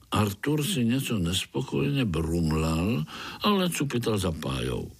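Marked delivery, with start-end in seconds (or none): none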